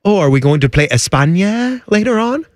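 lilting mock-Spanish question rising on España; mock Spanish pronunciation. Host mocking people who use native country names, in mock Spanish.

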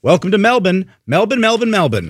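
Melbourne repeated as "MEL-bn", R dropped; mock broadcasters drilling Melbourne as "MEL-bun"; imitating broadcasters' pronunciation. Co-host imitating coached broadcasters, Melbourne said "MEL-bun" over and over. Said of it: "I never heard it pronounced correctly".

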